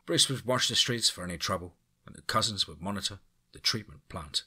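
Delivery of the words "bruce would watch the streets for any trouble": usual speaking voice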